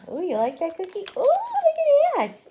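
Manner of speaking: high sweet pet voice; pet talk. Real, not acted. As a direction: coo at the dog. Talking to dog.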